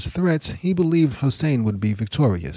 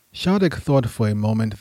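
usual speaking voice

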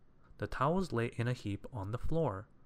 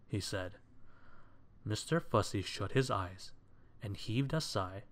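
plain reading voice

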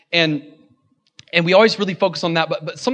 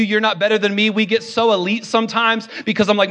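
ordinary delivery

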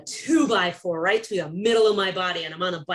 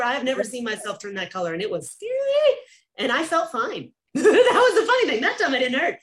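usual speaking voice

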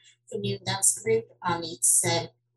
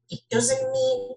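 ordinary talk

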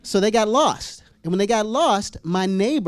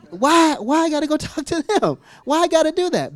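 whining protest; 8 semitones higher, 3 dB louder than usual. Retold or imitated. Acts protesting God's command.

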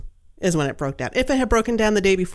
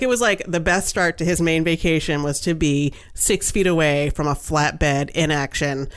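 normal talking voice

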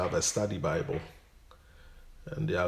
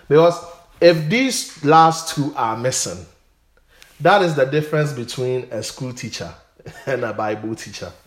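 louder and higher, driving the point home; preaching loudly; 5 semitones higher, 8 dB louder than usual. Real, not acted. Pastor urging the congregation, loud and fervent. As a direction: build it up like a preacher at the pulpit.

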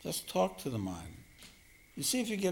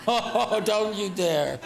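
loud, high, laughing protest; mock warning; 7 semitones higher, 7 dB louder, much slower than usual. Teacher joking with the audience, loud laughing mock warning. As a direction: burst out laughing in mock outrage.

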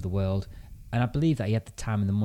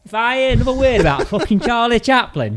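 loud, much higher grumpy British bloke; disgruntled British man; 12 semitones higher, 9 dB louder than usual. Retold or imitated. Brother fed up with hearing about Charlie, loud mock British grumble. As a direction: rant like a fed-up 1930s Brit. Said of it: "Disgruntled British man from nineteen thirty".

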